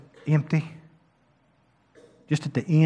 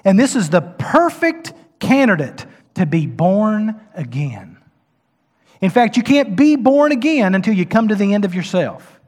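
impassioned preaching, raised and louder; 6 semitones higher, 8 dB louder than usual. Real, not acted. Preacher rising to his congregation, full force. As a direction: drive it home from the pulpit.

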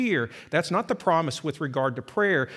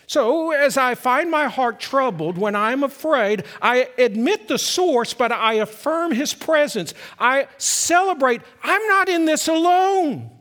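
louder, higher, full-force preaching; rising pulpit fervor; fervent preaching; 8 semitones higher, 6 dB louder than usual. Acted. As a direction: preach it out with conviction.

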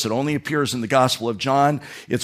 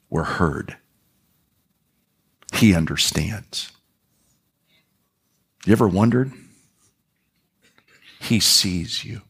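tender preaching; 6 semitones lower than usual. Acted. Pastor assuring the church it is seen, hushed.

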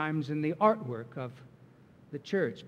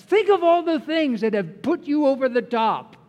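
higher and louder, urging; excited exhortation; 8 semitones higher, 9 dB louder than usual. Acted. Pastor urging congregation to recall joy, higher and louder. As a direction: lift them up.

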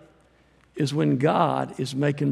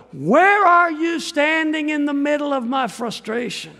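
much higher and louder cry; anguished cry; 9 semitones higher, 4 dB louder than usual. Acted. Preacher voicing a frustrated believer crying to God, higher and louder.

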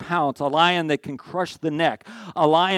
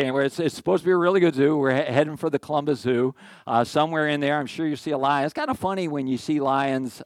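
ordinary talk, nothing marked

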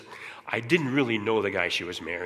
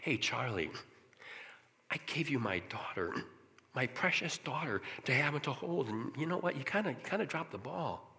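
very soft, tender reproach; hushed, intimate and non-judgmental; God, gently convicting; 3 semitones higher, 9 dB quieter than usual. Retold or imitated. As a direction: break it to him kindly, almost under the breath. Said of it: "It was a sweet, gentle conviction".

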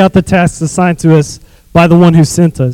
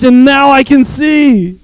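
high, slow shout of joy; shouting he can see; joyful proclamation; 7 semitones higher, slower than usual. Acted. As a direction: cry out the miracle. Healed man proclaims.

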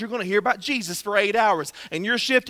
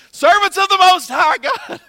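much higher, louder, slow mock proclamation; mock king; 9 semitones higher, 8 dB louder than usual. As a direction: ham up the newly converted king. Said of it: "He's like".

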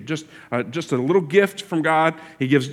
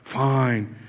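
a resigned, much softer lower Fine; a sulky teen finally giving in to a parent; grudging give-up; 4 semitones lower, 6 dB quieter than usual. Retold or imitated. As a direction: sigh out Fine like a kid who's lost the argument. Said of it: "your kids when they finally give up".

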